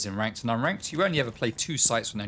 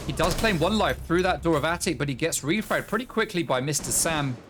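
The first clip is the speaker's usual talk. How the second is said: energetic esports caster calling the action; brisk match commentary; live play-by-play; 6 semitones higher, 3 dB louder than usual. Acted. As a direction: call the round as it unfolds.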